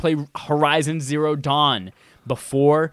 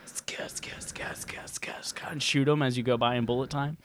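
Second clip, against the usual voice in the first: whispered skate sounds; 8 dB quieter than usual. Retold or imitated. Skateboard wheel sound effect.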